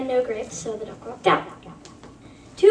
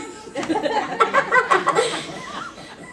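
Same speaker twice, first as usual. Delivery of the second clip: high laugh at own punchline; 9 semitones higher than usual. Real, not acted. Laughing at own muffin punchline, a high laugh. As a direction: crack up after the joke.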